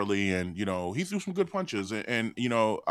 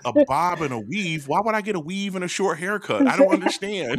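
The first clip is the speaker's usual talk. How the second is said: higher, confused mock voice; mock Lisa; 8 semitones higher, 3 dB louder than usual. Friend mocking Lisa misreading boxing advice, confused and high. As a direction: puzzle over the word like a dimwit. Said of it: "She was like".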